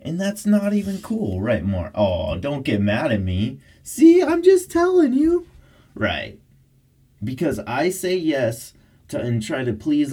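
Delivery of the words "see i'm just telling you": high, loud coo. Real, not acted. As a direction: placate the dog, squealing up high. Dog gets mad.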